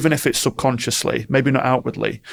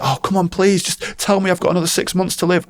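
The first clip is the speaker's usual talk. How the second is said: high, begging plea; pleading patient; 6 semitones higher than usual. Retold or imitated. Patient begging doctor for good news, high pleading. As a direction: beg for more time, pitched up.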